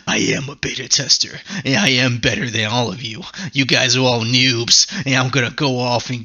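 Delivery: smug nasal whisper, lightly voiced; mock the smug gamer; nasal Kirito voice. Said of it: "he has such a nasally voice".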